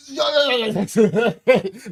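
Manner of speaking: a breaking, overwhelmed laugh; emotional laugh. Real, not acted. Teacher laughing at his own class notes, overwhelmed. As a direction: laugh it out, close to tears. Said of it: "sorry, I'm emotional like this".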